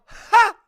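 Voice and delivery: a single sharp, loud, high "Ha!" bursting out; sudden laugh burst. Retold or imitated. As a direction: blurt one loud laugh into a silent theater. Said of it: "And I go".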